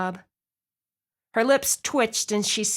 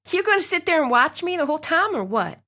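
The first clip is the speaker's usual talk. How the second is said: quick, higher, louder snap; snapping; 8 semitones higher, 3 dB louder, faster than usual. A narrator's reading. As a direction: snap at him impatiently. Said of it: "she snapped".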